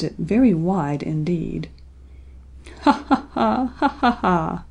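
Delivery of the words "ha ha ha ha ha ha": storybook toad's laugh, starting high and dropping; spoken-out "ha, ha, ha" in two bursts; acted laugh. A narrator's reading. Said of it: "laughed Mister Toad".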